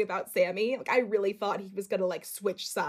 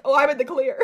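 relieved smugness; 5 semitones higher, 9 dB louder, faster than usual. Retold or imitated. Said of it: "Tom's like".

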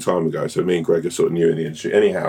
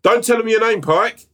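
high shouted call; shouting; 3 dB louder than usual. Retold or imitated. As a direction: call it out across the carpet. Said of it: "So I shouted out".

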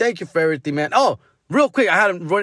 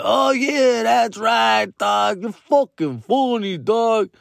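put-on drawn-out, higher drunk fan; mock drunk guy, stretched and pitched up; drunk fan impression; 4 semitones higher, much slower than usual. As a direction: imitate a tipsy fan gushing. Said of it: "They were kinda drunk".

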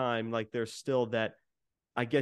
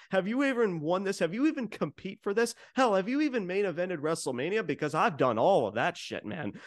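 mocking taunt; 7 semitones higher than usual. Roman taunting Cody, retold.

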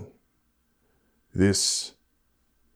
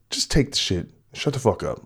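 ordinary talk, nothing marked

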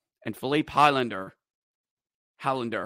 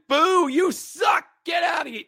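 mock booing; 3 dB louder than usual. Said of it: "It wasn't".

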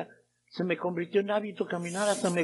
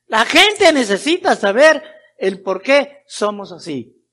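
insistent, raised pulpit exhortation; fervent preaching; 6 semitones higher, 9 dB louder than usual. Real, not acted. Preacher urging believers to share the secret of life, fervent preaching. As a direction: drive each phrase home to the crowd.

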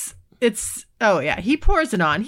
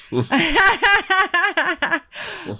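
delighted laugh; 8 semitones higher than usual. Real, not acted. Laughs at listener message.

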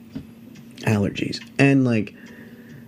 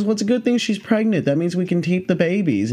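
higher-pitched, breezy handler voice; unbothered snake handler; 7 semitones higher than usual. Retold or imitated. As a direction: imitate a pro who finds this routine. Handler quoted, cheerfully unbothered.

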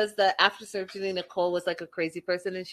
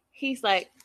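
ordinary talk, nothing marked

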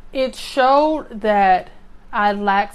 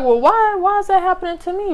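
baffled question; 9 semitones higher, 4 dB louder, faster than usual. Retold or imitated. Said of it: "And I'm sitting there like".

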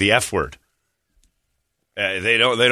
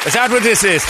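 high, louder clueless caller; Ray; 10 semitones higher, 4 dB louder than usual. Retold or imitated. Jailed trainer learning where he is, high voice. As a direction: ask it pitched up, dawning on him. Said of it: "I got a call from him".

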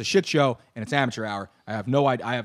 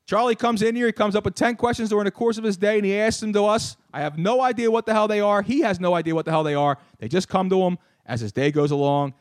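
ordinary talk, nothing marked

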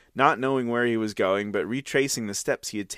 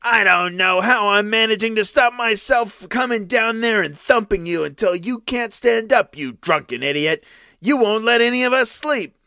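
much higher, louder furious threatening roar; harsh threat; 10 semitones higher, 5 dB louder than usual. Acted. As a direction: threaten to come down and thump him. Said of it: "said more harshly than ever".

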